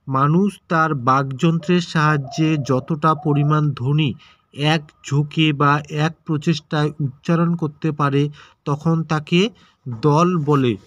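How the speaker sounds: ordinary talk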